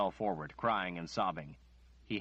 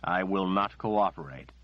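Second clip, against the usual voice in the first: louder, forceful, unbending; firm refusal; 7 dB louder than usual. Acted. Nathan refuses Margaret angrily and loudly. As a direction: slam the door on the request.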